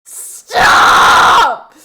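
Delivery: drawn-out, full-blast scream; screamed command. Real, not acted. Streamer begging chat to stop, screaming. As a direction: scream it long and very loud.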